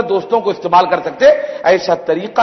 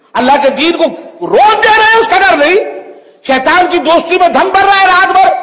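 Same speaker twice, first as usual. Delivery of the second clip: preacher raising his voice against fear of Satan; fiery preaching; 6 semitones higher than usual. Acted. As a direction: thunder the rebuke at the congregation. Preaching at full force.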